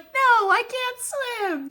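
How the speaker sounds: very high, slow cry of I can't swim; mock-panicked high yelp; Johnny's wail. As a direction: squeal it like a boy being swept away. Johnny voiced fading in car wash.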